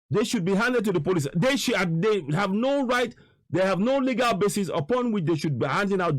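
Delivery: real outrage at the footage; outraged. Real, not acted. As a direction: demand justice, voice climbing. Outrage at beating video.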